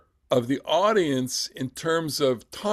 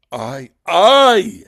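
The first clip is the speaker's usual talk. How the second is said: loud, higher, swelling cry; dramatic outburst; 6 semitones higher, 12 dB louder than usual. Acted. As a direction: burst out on the word I. Trying out Joseph's big reveal.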